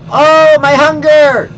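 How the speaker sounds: slow, high, loud anguished cry; dramatic wail. Acted. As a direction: ham up the despair. Hunger meter drops.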